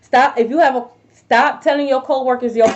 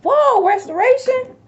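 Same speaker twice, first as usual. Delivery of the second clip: surprised; 12 semitones higher than usual. Real, not acted. Seeing the restoration card.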